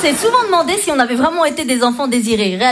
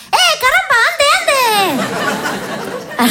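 much higher, hammed-up Latin American accent, laughing; mock Spanish accent; 13 semitones higher, much slower than usual. People imagine she talks like this, hammed-up Spanish accent. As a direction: play up a cartoon Spanish accent. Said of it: "on pense que je parle comme ça".

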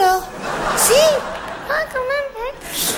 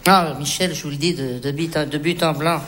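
tender confession; 10 semitones lower than usual. Acted. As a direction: confide it gently.

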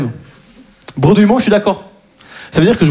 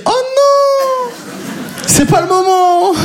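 very high, drawn-out mock wail; panicked inner voice; much slower than usual. Stoned man spotting police talking to him, high comic panic. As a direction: squeal of dismay, stretched slowly. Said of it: "Et moi, je me dis".

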